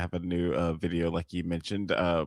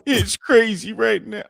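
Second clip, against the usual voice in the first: much higher, louder, slow put-on rapper voice; 6 dB louder, slower than usual. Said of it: "I can't do that either".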